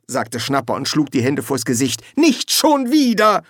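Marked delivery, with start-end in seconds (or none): 2.1-3.4 high, loud, drawn-out protest; exasperated. Acted. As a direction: wail in mock despair. Schnapper despairing at the same old scene.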